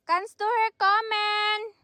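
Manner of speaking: high, slow call across the garden; yelling across the room. Real, not acted. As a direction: call out to someone far off. Calling son over from garden.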